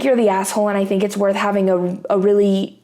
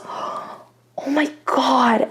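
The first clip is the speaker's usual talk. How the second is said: horrified; 3 semitones higher, 3 dB quieter, much slower than usual. Retold or imitated. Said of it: "he goes".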